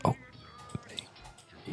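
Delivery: ordinary talk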